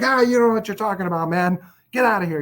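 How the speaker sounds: scoffing get-out-of-here, pitched high and quick; dismissive brush-off. Retold or imitated. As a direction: laugh him off and push him away. Brushing off the friend's criticism.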